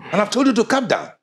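loud, high, forceful order; stern rebuke. Acted. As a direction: raise your voice to stop him. Father repeats his order to son.

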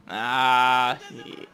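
normal talking voice

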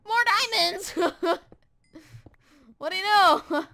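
loud thrilled yell at the find; excited shout. Real, not acted. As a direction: shout it out, overjoyed.

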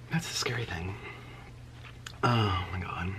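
hushed, whispered dismay; whispering. Real, not acted. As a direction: drop to a whisper.

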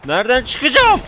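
loud, high-pitched yell; real outburst of frustration, pitch shooting up; frustrated shout. Real, not acted. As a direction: shout it out, desperate for an exit. Stuck, same question now yelled.

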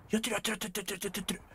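softly muttered やってる, やってる repeated low; murmured. Real, not acted. Watching teammates fight, murmuring やってる softly. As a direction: murmur やってる under the breath.

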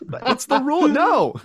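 high, loud mock objection; hammed-up protest. Joking over the camera rule, hammed-up protest. Acts out pleading against the rule.

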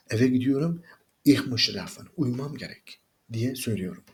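ordinary talk

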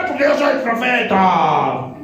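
slow, drawn-out mock-pompous voice; proud prophet. Preacher mocks prophets who allow no contradiction, proud prophet voice. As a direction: imitate a self-declared prophet, stretching the words. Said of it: "Les crece el orgullo y el ego".

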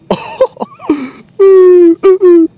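long high shrieking laugh; loud laughter. Real, not acted. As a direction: burst out laughing at the screen. Reacts to game mishap.

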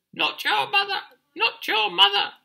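very high, loud bird squawk. Acted. Birds in the trees warn the chick, high squawk. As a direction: squawk a piercing warning in falsetto. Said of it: "squawked the birds in the trees".

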